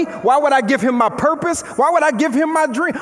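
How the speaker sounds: usual speaking voice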